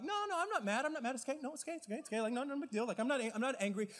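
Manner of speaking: high, sweet insistence that all is fine; mimicking a passive aggressive person, high-pitched and falsely cheerful. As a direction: chirp it brightly while clearly upset. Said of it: "passive aggressive people that are like".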